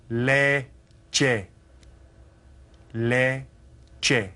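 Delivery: Leche said long and clearly, both vowels held; clear model word. Shown as a demonstration. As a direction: model the word for students to copy. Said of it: "Now listen to it".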